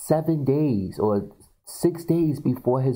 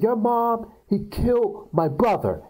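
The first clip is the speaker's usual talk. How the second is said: mock accusation; 9 semitones higher than usual. Acts out confronting brother.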